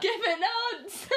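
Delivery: high, laughing outcry; mock demand. Co-host echoing the story's line for fun, mock crying out. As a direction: parody the boy's cry with a laugh.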